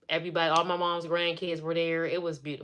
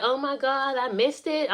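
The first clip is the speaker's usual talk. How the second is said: high-pitched retold dismay; stunned disbelief; 8 semitones higher than usual. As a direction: imitate the sister realizing it. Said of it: "She could not believe it".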